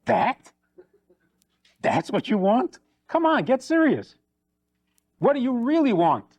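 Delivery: high, mocking come on; incredulous husband. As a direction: brush it off as trivial. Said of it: "He says".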